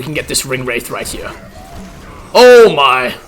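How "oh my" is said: 1 startled exclamation. Real, not acted. Reacts during fight.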